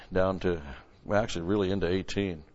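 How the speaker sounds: normal talking voice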